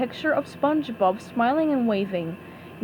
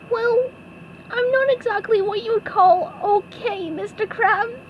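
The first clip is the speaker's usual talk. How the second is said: much higher, crying cartoon voice; tearful SpongeBob; 10 semitones higher than usual. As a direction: choke it out through tears.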